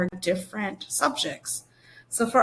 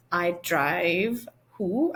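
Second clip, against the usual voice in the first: quiz question; much slower than usual. Shown as a demonstration. Teacher quizzing the class.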